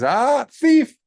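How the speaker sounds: shrill high cry of accusation; a sky spirit catching a thief red-handed, loud and high; high loud deva voice shouting thief; accusing deva. Acted. Earth deva accuses the monk.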